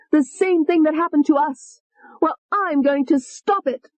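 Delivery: high, venomous female alter; high hateful young woman; vicious Tony. Acted. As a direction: sneer the threat up high. Tony's voice.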